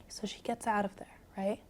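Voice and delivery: whispered bedtime storytelling; hushed whisper. Real, not acted. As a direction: whisper it so a drowsy listener drifts off. Repeated softly as listener grows sleepy.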